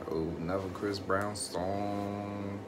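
usual speaking voice

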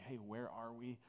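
soft, low retold question to a neighbour; confiding aside. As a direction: lean over and ask it under your breath. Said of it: "lean over to them and just say".

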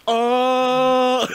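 loud, high drawn-out "uh"; mock blank panic, held long; stumped. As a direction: freeze up on a long, high stalling noise. Caught out by Japanese question.

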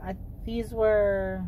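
ordinary talk

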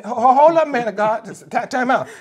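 high, loud, desperate shouting; a mother yelling that her sons are in danger; panicked cry to the prophet for help; frantic widow. Acted. Desperate widow confronts man of God.

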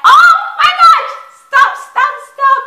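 high, loud fangirl shriek, re-enacted; retold excited scream. Reaction vlogger retelling her discovery of the song, mock excited scream. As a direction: act out screaming at a surprise find. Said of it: "I started screaming".

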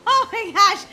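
loud, high cackling disbelief; incredulous laughter. Acted. Girl mocks sister's princess claim, laughing hard. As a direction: crack up laughing between the words.

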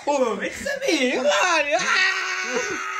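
high loud laughing shriek; shrieking yell. Acted.

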